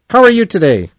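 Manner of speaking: high-pitched, loud cheery question; cheerful. A narrator's reading. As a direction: ask it brightly with a lifted voice. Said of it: "he said cheerfully".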